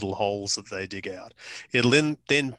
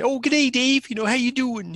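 high-pitched, badly faked Midwest American accent; mock Midwest accent; 13 semitones higher, 3 dB louder than usual. Midwest couple greeting the visitor, high mock Midwest accent. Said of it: "I can't do the accent".

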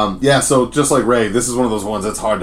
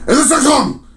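high, loud robot-like stage shout; belted robotic song intro, pitched way up; robotic frontman; 5 dB louder than usual. Retold or imitated. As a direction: imitate a singer announcing like a robot. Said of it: "he talked like a robot".

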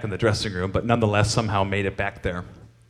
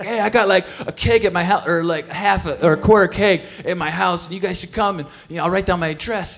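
higher put-on voice of the singer; 9 semitones higher than usual. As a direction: rattle off the keg invite in his voice. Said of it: "he's like".

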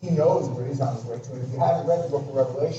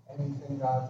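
hushed prayer; 4 semitones lower, 11 dB quieter than usual. Acted. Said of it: "like David said".

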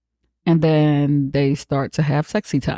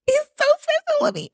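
put-on very high, pleading child's voice; little daughter refusing a new bunny; whining little girl. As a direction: beg like a kid who wants her bunny back. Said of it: "But she's like".

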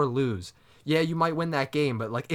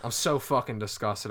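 ordinary talk, nothing marked

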